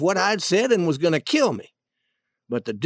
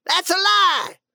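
high, shouted outburst; acted indignant yell; furious denial; 12 semitones higher than usual. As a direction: explode in denial. Duke denies accusation furiously.

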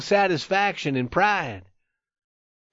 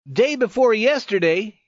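higher-pitched, emphatic challenge; daring reply; 6 semitones higher than usual. A narrator's reading. Youth challenging his friend's old boasts, with daring. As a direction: venture it boldly, pitch lifted. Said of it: "he finally replied with daring".

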